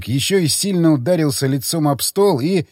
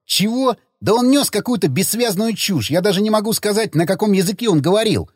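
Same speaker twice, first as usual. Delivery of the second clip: high-pitched, quickening disbelief; bewildered protest; 7 semitones higher than usual. Acted. Friend insisting the drunk talked incoherent nonsense, bewildered. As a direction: protest it, baffled and flustered.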